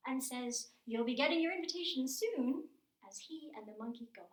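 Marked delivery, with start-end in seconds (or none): 0.9-2.7 high and loud, gleeful taunt; triumphant call. Acted. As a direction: shout it back as you escape. Mouse Deer gloats after tricking crocodiles.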